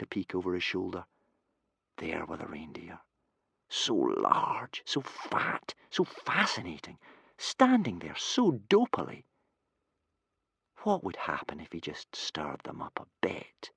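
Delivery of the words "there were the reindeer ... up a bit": hushed narration. A narrator's reading. Narrating dragon's tempted thoughts.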